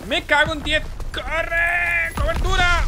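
high-pitched shouting under fire; urgent shout. Real, not acted. Player under fire calling for cover, urgent high shout.

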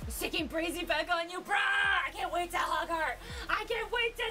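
usual speaking voice

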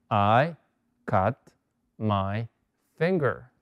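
I cut my finger spaced out with pauses between words; word-by-word dictation. Shown as a demonstration. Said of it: "dictation word by word".

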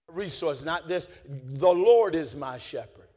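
ordinary talk, nothing marked

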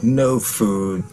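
ordinary talk, nothing marked

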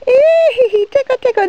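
high, squealing tickle tease; playful tickling voice. Pretend. Mom tickling child with feather.

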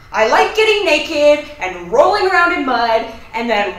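loud, bursting admission; blurted confession. Acted. Cornered roommate confessing mud habit, blurting loudly. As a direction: shout the secret out in one go.

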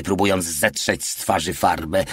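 ordinary delivery

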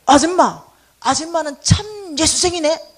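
very loud slow call. Retold or imitated. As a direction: shout it out slowly across the street. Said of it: "이러는 거야".

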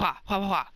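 rapid karate-chop wahs; playful karate master sound effects; mock karate noises. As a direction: chop the air with silly martial arts cries. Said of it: "I'm just like".

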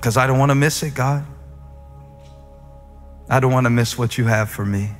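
ordinary talk

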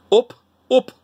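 northern English vowel in "up"; Manchester accent. Shown as a demonstration. Vlogger demonstrating Manchester, northern English accent. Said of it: "how about Manchester".

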